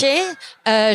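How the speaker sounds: ordinary talk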